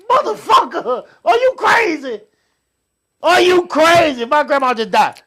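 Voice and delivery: high, loud, incredulous retort; indignant outburst. Retold or imitated. Grieving grandson snapping back on the ride, indignant outburst.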